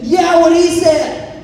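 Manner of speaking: put-on high, loud, slow kid cheer; eager backing up. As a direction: cheer your brother on, loud. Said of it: "I said".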